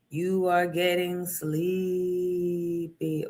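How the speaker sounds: slow drawn-out hypnotist voice; mock hypnotist. Host swinging a trinket at cohosts, slow hypnotist drone. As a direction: lull them like a stage hypnotist.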